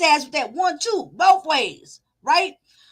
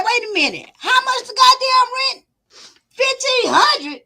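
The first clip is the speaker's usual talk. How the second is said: mock disbelief; 9 semitones higher, 5 dB louder than usual. Commentator acting the tenant shocked at the real rent. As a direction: stretch it out high in outraged disbelief. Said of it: "And then she said".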